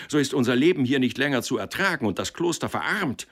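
high, heated retort; shouting faction. A narrator's reading. Rival faction warning against Marcelin, called out. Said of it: "riefen die anderen".

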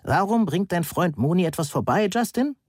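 high-pitched, mildly outraged question; indignant. A narrator's reading. Heike questioning Justin about his friend, indignant. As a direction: demand an answer, pitch climbing at the end. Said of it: "der immer noch empörten Frau".

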